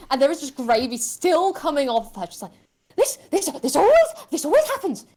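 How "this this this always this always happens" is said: high, fast, frantic lady; frantic noblewoman rant. Acted. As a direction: gabble it in a panic.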